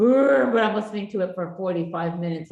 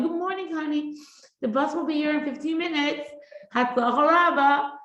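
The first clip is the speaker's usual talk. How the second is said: much higher, sing-song sweet voice; sweet loving wake-up; 8 semitones higher, 3 dB louder than usual. Shown as a demonstration. Mother waking child sweetly, high sing-song. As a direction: wake them with honeyed kindness. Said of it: "You stay nice and loving".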